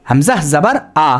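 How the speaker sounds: "Hamza জাবার আ" drawn out slowly, syllable by syllable; slow demo. Shown as a demonstration. ("Hamza জাবার আ" ধীরে ধীরে উচ্চারণ করে দেখানো)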